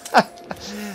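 high delighted laugh; excited laugh. Real, not acted. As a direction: burst out laughing. Excited about dinosaurs.

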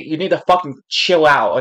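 ordinary talk

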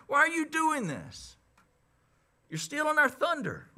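high-pitched, mocking the offended priests; indignant priests. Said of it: "So the priests were saying".

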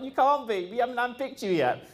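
high, indignant trainer voice; mock trainers. Trainers telling a trainee he's no leader, high and indignant. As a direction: imitate them scolding the upstart. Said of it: "they're like".

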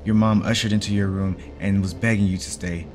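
ordinary delivery

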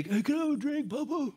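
put-on high, soft child voice; little child. Preacher voicing a small child asking dad for a drink, high and soft. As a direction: ask sweetly like a small kid. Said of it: "and they".

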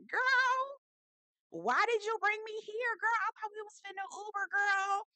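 high, squeaky mock voice of a put-out wife; imitating Jessel. Vlogger voicing Jessel complaining to her husband about the subway, high mock whine. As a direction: pitch way up to play the disappointed wife. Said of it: "Jessel looking like".

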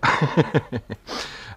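rapid high chuckle; real amused giggle; self-mocking laugh. Real, not acted. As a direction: laugh at your own bad accent. Laughs at own Chinese accent.

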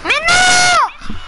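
sudden high furious shout; raging gamer. Real, not acted. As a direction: yell it out in outrage. Accused of lying, gamer rages.

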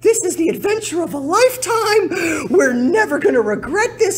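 high, loud, giddy impression of his wife; cheerful wife. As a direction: ham up her relentless enthusiasm. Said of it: "she's like".

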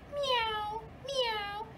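high little meows; cat meow. Acted. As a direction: mew like a small cat.

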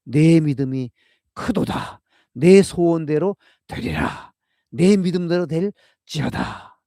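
loud, slow, lifted proclamation; Jesus's blessing proclaimed loud and slow; proclaiming Jesus's words. Retold or imitated. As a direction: declare it with authority, slow and ringing. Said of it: "예수님은 단 한 번도 그런 얘기 하신 적 없어요".

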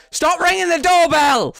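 high, loud frantic yell; panicked shout. Real, not acted. Yelling at doorbell in game.

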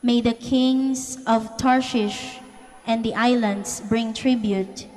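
ordinary talk

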